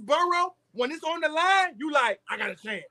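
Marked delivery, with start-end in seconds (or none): 2.3-2.8 hopeful fan. Retold or imitated. Imitating fan watching top quarterback.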